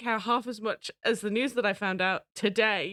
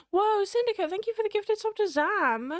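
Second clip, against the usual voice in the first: much higher, bright excited shout-out; excited thanks; 11 semitones higher than usual. Real, not acted. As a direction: burst out in delighted thanks.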